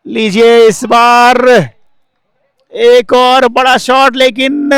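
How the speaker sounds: high, loud and slow call; excited cricket commentary. Real, not acted. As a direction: build up the big shot. Big shot call repeated.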